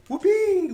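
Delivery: high, drawn-out "Whoopee" squeal; whoopee catchphrase. Retold or imitated. Game master imitates another player's whoopee, high and drawn out. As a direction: stretch out WHOOPEE in a high, playful call. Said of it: "I don't do it as good".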